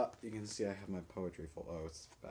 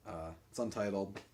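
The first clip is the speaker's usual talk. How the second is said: ordinary talk, nothing marked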